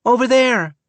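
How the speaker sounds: put-on child spotting the turn; young girl pointing. A narrator's reading. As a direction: point it out eagerly. Abigail spots the road.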